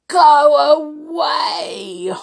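loud, high, drawn-out roar. A narrator's reading. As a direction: bellow it slowly like a storybook beast. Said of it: "roared the beast".

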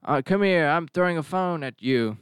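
mock him with a muddled accent; half-hearted unplaceable accent for Depp; vague mock accent. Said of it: "Not really sure what his accent is".

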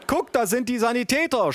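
high, excited mother calling out; mock yell. A narrator's reading. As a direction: cry out high, hammed-up. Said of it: "schrie meine Mutter". (gespielt schreiende Mutter)

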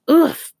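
sudden high, loud "oof"; gasped oof. A narrator's reading. Stumbling onto someone's lap, a high gasped oof. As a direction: let out a startled, winded oof. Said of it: "she gasped".

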